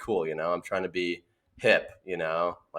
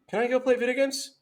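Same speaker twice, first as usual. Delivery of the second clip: put-on high, fast, sugary little-kid ask; innocent kid; faster than usual. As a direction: sweetly dodge the trouble. Kid changing subject to dad.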